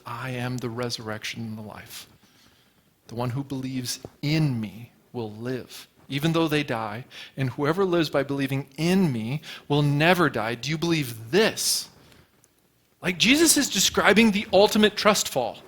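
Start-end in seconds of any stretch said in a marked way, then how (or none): 0.1-11.9 quiet scripture reading. Acted. Preacher to congregation, reading Jesus' words in a hushed voice. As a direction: softly read the words of Jesus, hushed and steady.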